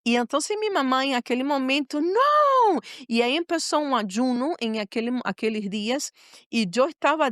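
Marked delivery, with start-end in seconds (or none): 2.0-2.8 high, drawn-out wail of "no"; anguished outcry, re-enacted; retold cry of dismay. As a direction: cry out in a mother's disbelief, pitch leaping. Retold mother's cry at diagnosis.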